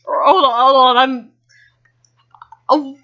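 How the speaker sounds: high, loud stunned curse; horrified disbelief; shocked. Real, not acted. Shocked by the plot twist.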